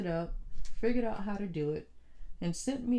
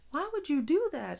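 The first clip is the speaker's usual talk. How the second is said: exasperated; 9 semitones higher, 4 dB louder than usual. Retold or imitated. Exasperated at sister.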